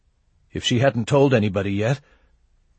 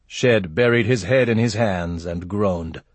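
usual speaking voice

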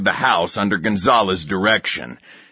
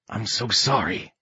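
quiet; 7 dB quieter than usual. Acted. Comrade consoling a grieving soldier.

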